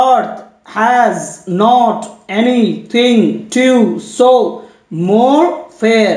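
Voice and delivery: slow, separated syllables with small gaps; measured scansion reading, each beat set apart. Shown as a demonstration. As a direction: count out the line one syllable at a time. Counting syllables of a sonnet line.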